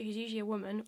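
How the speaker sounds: usual speaking voice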